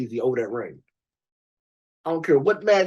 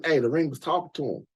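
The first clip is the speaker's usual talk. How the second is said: ordinary talk, nothing marked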